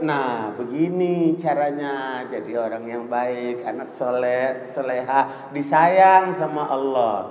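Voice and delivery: put-on low, slow and soft, as to a small child; slow, soothing parent voice; gentle parental coaxing. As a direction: coax the child tenderly, drawing words out. Voicing a parent gently teaching a child.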